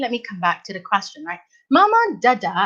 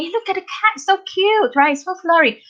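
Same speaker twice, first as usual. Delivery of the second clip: cheerful proper sentence; 9 semitones higher than usual. Shown as a demonstration. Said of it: "just say".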